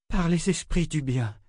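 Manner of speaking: high, hushed breath of a prayer. A narrator's reading. Former Mistress of Death breathes a prayer over Kara, high and hushed. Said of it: "souffla l'ancienne maîtresse".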